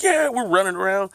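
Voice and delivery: high-pitched, elated mock cheer; retold cheering. Teens running off after police let them go, high excited cheer. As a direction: act out celebrating a lucky escape. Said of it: "We're running around cheering".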